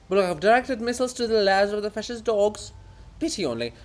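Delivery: high, loud, self-important rant; haughty author defending his greatness; pompous offended writer. Acted. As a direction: puff up with wounded vanity. Bulgakov gloats.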